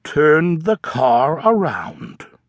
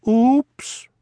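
higher sheepish oops; 7 semitones higher than usual. Acted. Froggy after dropping eggs on the director, sheepish high oops. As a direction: say it caught red-handed.

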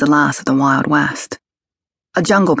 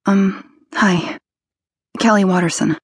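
put-on nervous young woman; 4 semitones higher than usual. A narrator's reading. Kelly voiced.

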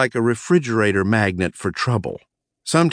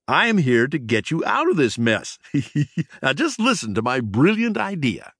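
high mouse voice with giggle; prankster mouse, gleeful; 6 semitones higher than usual. A narrator's reading. Prankster offers cousin his idea, high and gleeful. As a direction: pitch it with a squeaky little laugh.

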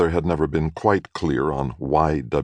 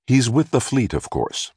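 proud mother's boast; 7 semitones higher than usual. A narrator's reading. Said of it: "The way she said it".

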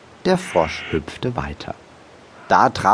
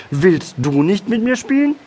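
very high, hopeful question; cheerful frog; 12 semitones higher than usual. A narrator's reading. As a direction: ask brightly for a playmate as a little frog. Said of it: "rief er fröhlich". (fröhliche, hohe Froschstimme)

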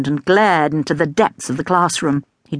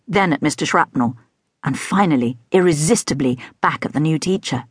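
plain reading voice

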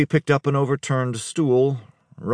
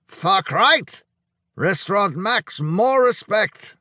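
put-on high, emphatic accented praise; loud Hungarian; 9 semitones higher, slower than usual. A narrator's reading. Drunk Hungarian praising the restaurant to its owner, loud and emphatic. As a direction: exclaim it in a thick accent, stretching the end. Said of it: "the Hungarian said loudly".

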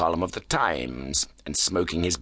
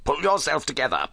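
higher-pitched urgent order; urgent cry; 7 semitones higher than usual. A narrator's reading. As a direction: urge him firmly, without shouting. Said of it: "I cried".

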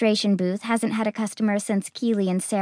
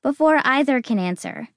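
plain reading voice

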